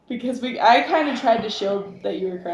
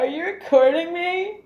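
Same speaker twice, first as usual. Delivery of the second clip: mock crying; 7 semitones higher than usual. Voicing crying friend again.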